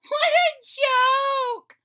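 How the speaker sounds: high, loud, slow mocking shout; robins' voice. Robins jeering at Toad's failed kite, high mocking shout. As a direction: jeer down at the kite like a cheeky bird. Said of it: "said the robins".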